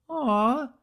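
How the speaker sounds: a high, pouting aw; disappointed creator. A narrator's reading. Creator sees the dropped cookie, high disappointed aw. Creator voiced in read story.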